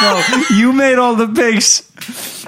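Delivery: slow, laughing protest. Real, not acted. Laughing denial.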